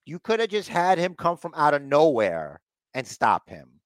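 ordinary talk

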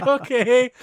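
high, loud, rising okay; awkward mock okay. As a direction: squeak out an uneasy agreement. Acted awkward reply to maid.

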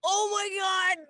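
high, awed exclamation building to a shout; thrilled at the coin; excited gasp. Retold or imitated. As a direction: gasp then cry it out in awe. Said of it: "I was so excited".

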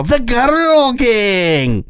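put-on very high, slow English accent on The Girl Gang; overdone English accent, high and slow. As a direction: stretch it out in a hammy English accent. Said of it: "really go into the accent, an English accent".

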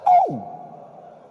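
a sudden very high loud "Oh"; mock surprise. Pastor yelps at the brother's heartbreak, in mock surprise. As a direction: yelp it out in feigned alarm.

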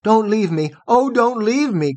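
high, desperate begging; pleading cry. A narrator's reading. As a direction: beg him not to go, frightened. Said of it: "cried Nycteris".

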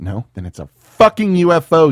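shouted insult; loud outburst. Acted. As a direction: yell it in disbelief. Arguing over the crash.

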